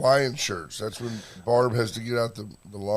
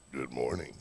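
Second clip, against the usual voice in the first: low, husky radio DJ voice with a cold; summer cold DJ voice; 12 semitones lower, 10 dB quieter than usual. Real, not acted. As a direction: croak it out like a sick morning DJ. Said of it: "summer cold F M D J voice".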